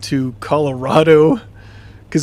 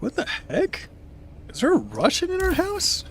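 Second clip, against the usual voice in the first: startled; 7 dB quieter than usual. Retold or imitated. Startled thought, waking up.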